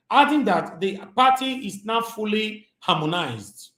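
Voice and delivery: lightly formal news-reading voice. Acted. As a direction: read the headline like a newscaster, slowed down. Reads news article aloud.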